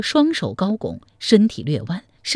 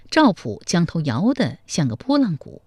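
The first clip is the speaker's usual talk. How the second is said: plain reading voice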